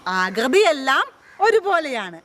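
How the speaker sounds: normal talking voice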